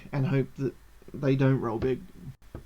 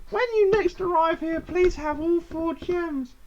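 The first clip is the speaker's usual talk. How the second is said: high sing-out gnome farewell; gnome trial master; 12 semitones higher than usual. Acted.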